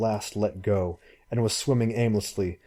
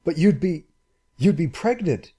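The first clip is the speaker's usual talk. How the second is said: higher, faltering alarmed cry; 8 semitones higher, 3 dB louder than usual. Acted. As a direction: stumble over it in alarm. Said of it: "he cried in alarm".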